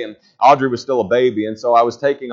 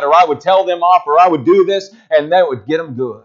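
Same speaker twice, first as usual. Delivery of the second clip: loud, high preacher's push; preaching at full force; 4 semitones higher, 3 dB louder than usual. Acted. Pastor warning the church about reliving old hurts, preaching loud. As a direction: press the point hard.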